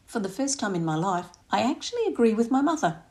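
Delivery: plain reading voice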